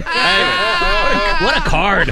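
high, very slow sarcastic drawl; dry mock praise, stretched out. Mock praise of a bad joke.

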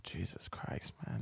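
hushed unvoiced aside; retold inner mutter; muttered to himself. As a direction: breathe it under your breath. Self-talk quote, whispered.

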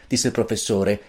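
usual speaking voice

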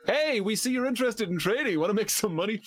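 high, mocking cold-caller voice; mock telemarketer. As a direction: ham up a pushy sales caller. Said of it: "getting phone calls from people being like".

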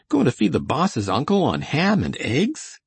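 high, swooping incredulous question; appalled disbelief. A narrator's reading. Coley objects to feeding the boss's uncle ham, appalled. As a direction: protest in high-pitched disbelief.

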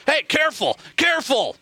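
high-pitched mock-offended warning. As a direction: playfully warn off a teasing listener. Mock objection to teasing listener text.

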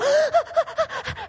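sharp high laughing gasp at hot sand; mock gasp. Youth speaker acting out burning feet on sand, a laughing high gasp.

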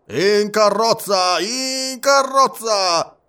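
high, long-drawn boarding call; conductor's shout. A narrator's reading. Conductor calling travelers back to the train, drawn-out shout. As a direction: call everyone aboard, loud and stretched out. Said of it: "gridava il capotreno".

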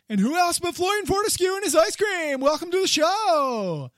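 high, loud game-show host welcome; showman announcement. Pretend. Introducing figure like a showman.

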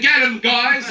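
usual speaking voice

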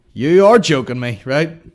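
loud, emphatic disbelief; loud exasperation. Real, not acted. Podcaster at his stalling internet, loud exasperation. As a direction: burst out, leaning hard on the words.

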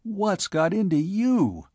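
high-pitched anxious question; worried, high-pitched challenge; anxious demand. A narrator's reading. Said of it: "demanded Haney anxiously".

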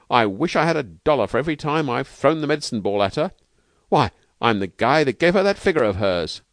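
breezy Steve. Acted. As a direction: brag and congratulate with lively gusto. Narrator voices slangy trainer Steve.

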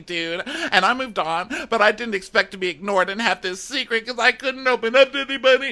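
high, wailing imitation of her; mock distraught. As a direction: hold a hammed-up weepy pitch to the end. Acting out her tearful speech.

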